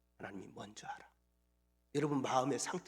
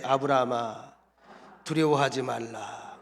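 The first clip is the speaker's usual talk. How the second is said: 아브라함아, 두려워하지 말라 called out louder and slow; louder repeat; 6 semitones lower, 5 dB louder, slower than usual. Shown as a demonstration. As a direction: say it back with more volume, slow on 두려워하지. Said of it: "더 크게".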